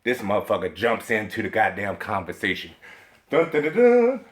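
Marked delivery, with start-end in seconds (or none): none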